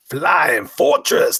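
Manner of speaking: loud, high, stretched-out hype call; dramatic title announcement. Pretend. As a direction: boom out the title, drawing it out.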